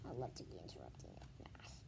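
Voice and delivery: a secret aside, whispered. Real, not acted. Reader confiding to the viewer, whispering. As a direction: let the listener in on a secret. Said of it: "whispered aside to viewer".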